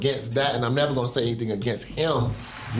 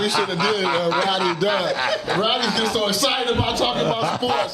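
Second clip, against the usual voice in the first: laughing tease; 4 semitones higher than usual. Real, not acted. Teasing laughter at the host.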